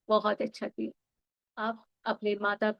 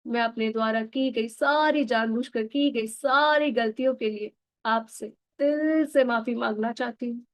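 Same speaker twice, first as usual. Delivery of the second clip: ordinary talk, nothing marked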